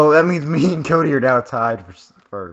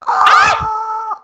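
high-pitched startled yell; sudden high "Ah!"; startled scream; 4 dB louder than usual. Real, not acted. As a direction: cry out as if caught off guard. Sudden scream during game.

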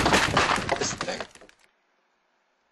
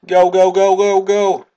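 loud, high, rapid-fire urging; urgent yelling; 8 semitones higher, 10 dB louder than usual. Real, not acted. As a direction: yell them on as they flee. Fleeing for life in game.

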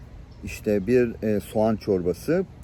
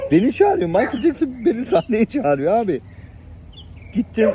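thrilled disbelief; 10 semitones higher, 3 dB louder than usual. Retold or imitated. Astonished at being called up.

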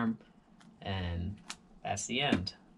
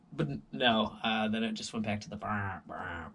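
normal talking voice

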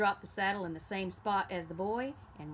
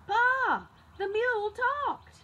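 high, loud frightened shout; boy shouting to his father; 9 dB louder, slower than usual. A narrator's reading. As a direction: yell it out to Pa in panic. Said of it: "he shouted".

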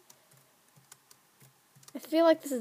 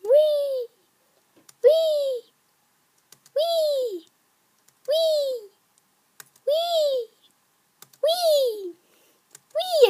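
very high squealed whee; Maxwell the pig; 13 semitones higher, 4 dB louder, much slower than usual. Pretend. Player hopping through jumps like an ad pig, high squeals. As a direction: squeal each one high, then let it slide down. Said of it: "I sound like Maxwell the pig from Geico".

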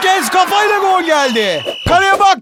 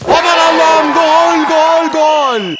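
screaming football commentator; 3 dB louder, slower than usual. Acted. Goal called in commentary.

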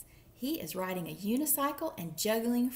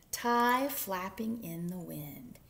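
plain reading voice

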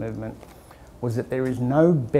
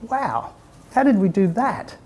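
amazed; 7 semitones higher than usual. Retold or imitated. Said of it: "and we just went".

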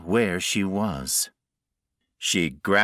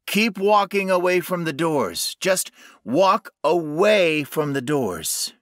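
higher, urgent raised voice; calling out; 9 semitones higher, 4 dB louder than usual. A narrator's reading. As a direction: guide her by calling out.

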